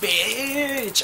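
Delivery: one high sudden "Bitch!"; alarmed inner cry. Retold or imitated. Her gut instinct warning her about the man, in a high alarmed cry. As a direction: jump up high, like your gut screaming.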